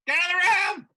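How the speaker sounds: loud urgent yell; shouting. Acted. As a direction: shout the order out. Yelling at party to leave.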